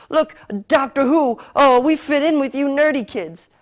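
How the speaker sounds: high, loud mocking imitation. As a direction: mimic a fake fan, sneering. Mocking the show's fake nerd talk.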